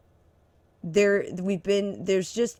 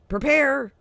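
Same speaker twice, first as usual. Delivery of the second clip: mocking official warning; 10 semitones higher, 8 dB louder than usual. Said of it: "like this announcement they made today".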